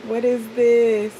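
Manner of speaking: drawn-out amazed oh my God; imitating excitement. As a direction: play the excited uncle. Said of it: "He's like".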